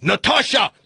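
high-pitched call in a mock Russian accent; hammed-up Russian summons; Russian husband yelling. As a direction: yell for your wife, comic Russian. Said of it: "yells to his wife".

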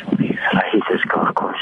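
hushed whisper into the phone. Real, not acted. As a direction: whisper so no one nearby hears. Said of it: "Why are you whispering?".

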